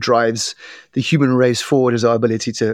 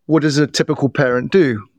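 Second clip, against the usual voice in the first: ordinary delivery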